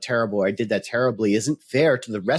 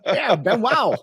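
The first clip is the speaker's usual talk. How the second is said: put-on playful high-pitched Cajun accent; 8 semitones higher, slower than usual. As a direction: say it like a Cajun Southerner. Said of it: "Cajun, maybe".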